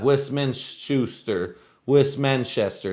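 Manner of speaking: ordinary delivery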